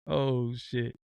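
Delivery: ordinary delivery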